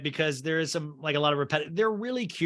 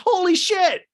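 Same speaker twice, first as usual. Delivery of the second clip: shocked exclamation; 9 dB louder, slower than usual. Retold or imitated. Edward shocked by the price.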